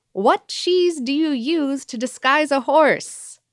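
slow, high, loud punchline; joke delivery. Acted. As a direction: drag out the punchline big. Reading out a listener's joke.

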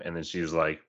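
ordinary talk, nothing marked

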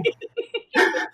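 high, loud burst of laughter; laughing at being called her twin. Real, not acted. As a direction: let out a bright laugh. Laughing at being called same person.

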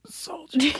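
high faint ghostly dying whisper; soft echoing dream voice. Pretend. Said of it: "can you do it as you die for me".

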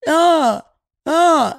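high, loud strained uh sounds; imitating toddler straining. Mimicking the toddler trying to say dog, straining. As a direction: imitate a toddler struggling to get a word out. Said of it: "She was like".

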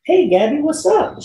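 high, breezy hello; cheery greeting. Retold or imitated. Actor greeting his co-star on set, cheery.

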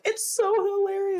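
high-pitched mock gushing; imitating giddy fans; mock insistence. Mock protest at the critics.

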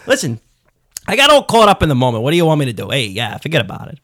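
hammed-up Staten Island wiseguy excuses; comic Staten Island tough guy; Staten Island accent. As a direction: shrug it off in broad Staten Island talk. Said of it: "like, a Staten Island accent".

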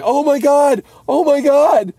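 very high, loud mock screaming; panicked wailing. Three friends in the puke-covered car, high panicked wailing. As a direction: shriek it in hammed-up horror.

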